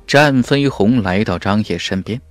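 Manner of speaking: ordinary delivery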